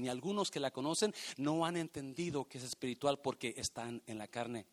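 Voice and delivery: ordinary talk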